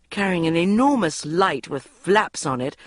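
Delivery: plain reading voice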